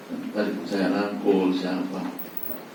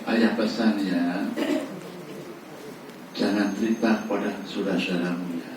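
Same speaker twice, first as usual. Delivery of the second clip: gentle and tender; 6 dB quieter than usual. Shown as a demonstration. Father Yakub warns his son gently, tender and soft. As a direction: hush it like a loving father. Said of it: "pesan dan intonasi yang lemah lembut".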